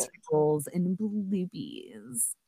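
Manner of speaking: slow breathy whisper. Pretend. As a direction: whisper it slowly, playfully. Whispered playful ending.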